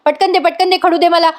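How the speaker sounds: pushy imitated shout at the constable; retold yelling. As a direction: bark out the orders like a bossy madwoman. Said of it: "ओरडत असते तिच्यावर".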